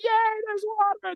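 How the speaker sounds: very high, squealed fake delight; mock cheering. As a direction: cheer like an overexcited crowd, hammed-up. Voicing the thirsty crowd cheering.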